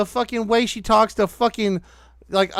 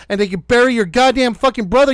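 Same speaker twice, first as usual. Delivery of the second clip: ordinary talk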